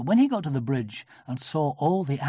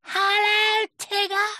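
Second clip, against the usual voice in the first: put-on very high, loud child voice; Roo; 5 dB louder, slower than usual. A narrator's reading. Little Roo greeting Tigger, very high. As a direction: squeal an eager greeting.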